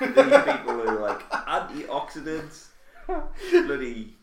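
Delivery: high, laughing mock voice. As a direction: send up the health nuts. Mocking antioxidant fans.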